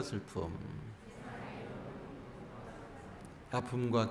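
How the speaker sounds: measured recitation, settling into low, soft repeats; linked call-and-response blessing. Shown as a demonstration. Monk guiding hands-on-chest affirmation, calm recited blessing. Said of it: "쭉 연결해서 한번 해볼게요".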